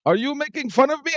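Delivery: very high-pitched imitation of an offended customer; mock the affronted customer, way up high. Customer challenging the KFC worker's accent, imitated in a high, affronted voice. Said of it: "the next thing I heard is".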